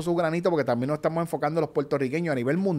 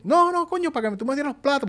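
quoting Vico; 9 semitones higher than usual. Retold or imitated. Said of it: "Vico me dice".

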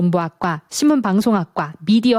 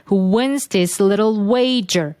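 very slow, stress on "wins" and "wager"; stress demo; much slower than usual. Shown as a demonstration. Teacher modelling where the stress falls. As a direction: press the stressed words slowly. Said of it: "이렇게 누르시면 되죠".

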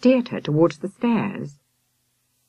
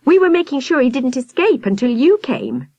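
put-on indignant girl; 9 semitones higher, 4 dB louder than usual. A narrator's reading. Victoria still complaining.

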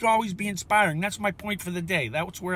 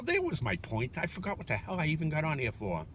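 ordinary talk

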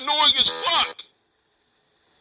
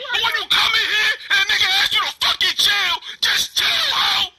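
enraged yelled order; furious shout; 6 semitones higher than usual. Real, not acted. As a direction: scream at her to calm down. Heated argument with Dia.

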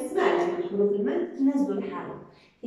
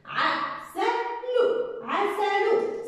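slow syllable blending; 8 semitones higher, much slower than usual. Shown as a demonstration. Teacher to pupils, very slow stretched syllable reading. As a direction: stretch out لو before landing on عسل. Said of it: "زي ما بقرأ بحلل".

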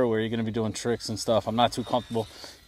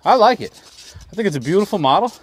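delighted; 6 semitones higher, 4 dB louder than usual. Real, not acted. Delighted praise for the plane.

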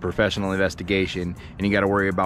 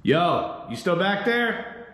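calling out; 9 semitones higher, slower than usual. Real, not acted. Calling to intruder in back.

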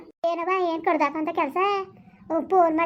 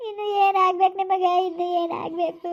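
wailing, sing-song grief; tearful lament; 2 semitones higher than usual. Acted. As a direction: lament through tears, fading at the end. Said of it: "ಅಳ್ಬೇಡಿ".

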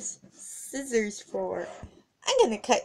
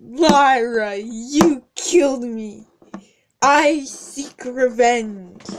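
loud, slow vengeful ghost voice; 8 dB louder, slower than usual. Acted. Returning victim confronting Lyra, slow and loud. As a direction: slowly threaten revenge, loudly.

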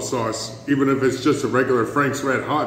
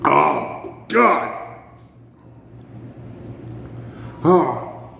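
gasping in pain, mouth on fire; strained, breathy gasps; 3 semitones higher, much slower than usual. Real, not acted. Mouth burning from hot sauce.

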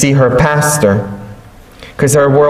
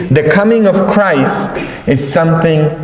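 ordinary delivery

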